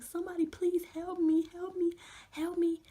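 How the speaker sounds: high, loud cry for help; screamed plea. Retold or imitated. Patient alone in the CT room, screaming for help. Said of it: "I am screaming".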